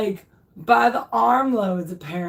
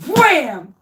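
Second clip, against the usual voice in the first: high, loud "Wham!" effect; mock impact; 6 semitones higher, 6 dB louder than usual. Acting out the blanket hitting his wife, mock impact. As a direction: hit it like a throw.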